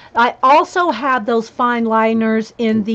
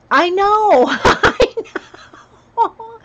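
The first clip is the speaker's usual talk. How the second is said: laughing; 9 semitones higher, much slower than usual. Real, not acted. Laughing at herself.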